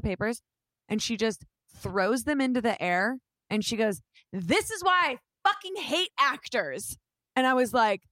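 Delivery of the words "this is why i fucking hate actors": higher, slow, disgusted outburst; mock angry rant. Casting director berating the auditioning actor, mock angry. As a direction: spit out each word like you're fed up.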